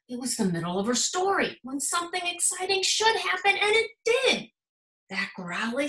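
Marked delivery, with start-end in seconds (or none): 1.6-4.5 rising excitement. A narrator's reading. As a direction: build the thrill. Building suspense in story.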